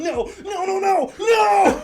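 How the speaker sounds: high, loud drawn-out shouting; commentator shouting. Retold or imitated. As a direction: shout the no's long and high. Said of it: "still doing his commentator voice".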